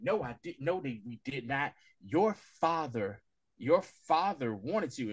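slow hushed denial. Retold or imitated.

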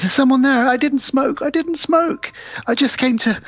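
high, frantic babbling; hammed-up guilty panic; mock panic. Caught, panicking man.

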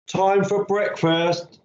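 high, drawn-out mum's call. A narrator's reading. Mum to Billy from downstairs, calling out. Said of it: "Mum called from downstairs".

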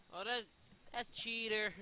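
high, loud indignant cry; indignant accusation. Real, not acted. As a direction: call out the cheater. Accuses opponent of cheating.

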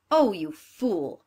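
loud, slow scornful sneer; wicked stepmother. Acted. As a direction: spit out the insult with contempt.

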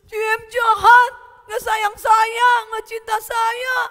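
high, loud tearful shouting; crying outburst. Acted. Boy accuses grandma of not loving him, bawling. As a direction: bawl the accusation at full voice. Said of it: "si Boynya nangis".

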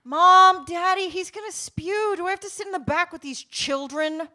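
put-on loud, high kid's complaint; chorusing from the back. A narrator's reading. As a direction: yell for Mom and Daddy in outrage. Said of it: "Rebecca chorused from the back".

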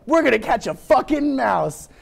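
high, slow, determined mock declaration; dramatic plan voice. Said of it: "That's the dramatic plan voice".

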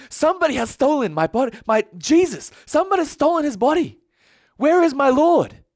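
high, loud panicked outcry. Acted. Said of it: "She starts to panic".

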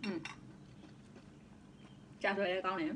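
muffled behind hand; chewing while talking. Real, not acted. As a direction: mouth full of food. Seen 0: chews food with lips closed, hand covering mouth from 1.5.

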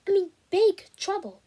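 put-on anxious boy pushing his case; urgent insistence. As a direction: make him believe it's serious. Boy pleading his worry.

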